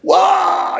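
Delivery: high shouted wow of amazement; loud amazed wow. Real, not acted. Amazed at meeting Pino's grandson, a high shouted wow. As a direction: let out a big astonished wow.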